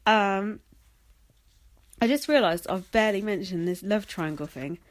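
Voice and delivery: ordinary talk, nothing marked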